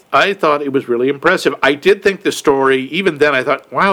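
ordinary delivery

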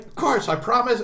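put-on childish promise.

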